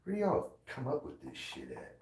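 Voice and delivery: ordinary talk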